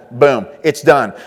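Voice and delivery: ordinary talk, nothing marked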